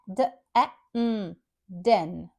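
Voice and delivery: "The A, mm, den" as separate sounds d, short eh, n, then blended; sounded-out short E. Shown as a demonstration. Teacher breaking den into its sounds for learners, segmented then blended. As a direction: sound out each letter of "den", then say it whole. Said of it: "Here letter E makes crisp A sound".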